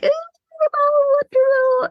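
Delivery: a high, put-on whiny ooh; hammed-up high moan; mock whine. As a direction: squeal a fake chirpy ooh. Pretending to be all peppy.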